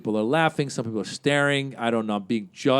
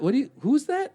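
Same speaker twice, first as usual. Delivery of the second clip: startled; 11 semitones higher than usual. Retold or imitated. Comic startled by heckler.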